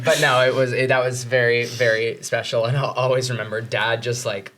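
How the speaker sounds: ordinary talk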